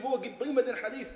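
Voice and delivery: high, loud challenge; impatient demand. Retold or imitated. Wife demanding the hadith from her husband, impatient. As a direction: demand it on the spot, heated.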